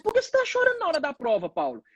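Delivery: fast, high, concerned exam proctor; high worried proctor voice. Retold or imitated. Exam proctor asking the crying student why, high and fast. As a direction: rush the worried question up high.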